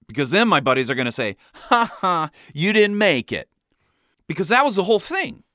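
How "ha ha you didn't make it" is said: higher-pitched teasing jeer; mock taunt. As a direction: tease like a gloating buddy. Buddies' taunt acted out.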